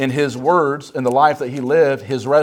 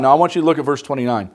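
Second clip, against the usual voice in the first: ordinary delivery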